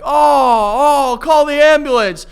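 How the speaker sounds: loud, high-pitched mock cry; meme character's shout. Quoting a favourite video to a friend, hammed-up shouting. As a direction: shout it big, dragging the final words out. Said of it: "where it's like".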